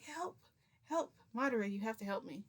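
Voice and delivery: high-pitched, urgent plea; retold cry for help. Storyteller begs Madara to save her, pleading. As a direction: call out to a friend for rescue.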